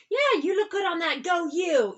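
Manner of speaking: high, gushing pretend compliment; playful fake praise. Adult playing pretend tea with a kid, high playful praise. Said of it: "a play lie, like with a kid".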